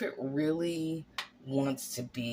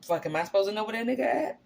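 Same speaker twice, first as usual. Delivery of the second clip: fast, higher, dismissive; modern street translation; 6 semitones higher, much faster than usual. Retold or imitated. Cain's words in modern slang, fast and dismissive. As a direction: fire it off like you couldn't care less. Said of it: "translate in the modern day as".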